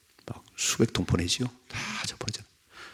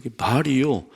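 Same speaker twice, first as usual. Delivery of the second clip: ordinary delivery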